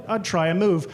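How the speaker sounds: ordinary delivery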